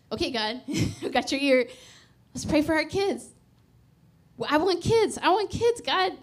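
high, loud mock pleading with God; insistent prayer. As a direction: plead for kids, pitch climbing with urgency. Acting out their pleading prayer for kids.

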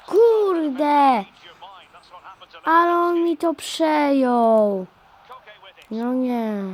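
loud, annoyed groan of defeat; frustrated outburst. Real, not acted. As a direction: vent at the game, loud and slow. Ball taken again in game.

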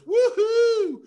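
very high, loud whoop of delight; whooping cry. Acted. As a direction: whoop with joy as he grabs it. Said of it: "and he goes".

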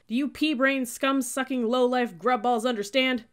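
loud, high drill-sergeant abuse; yelling. A narrator's reading. Cadet to stupid new recruits, yelling. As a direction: hurl each insult at the squad. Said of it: "stepped back and yelled".

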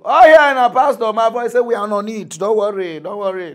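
high and loud, brushing off worries; mock pastor. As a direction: play the smooth pastor. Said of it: "pastor reassuring the woman".

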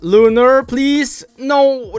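pleading. Real, not acted. Begging the last case to hit.

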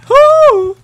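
very high, loud "woo"; high whoop. Real, not acted. Gamer whooping during the chase, high whoop. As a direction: let out a piercing whoop.

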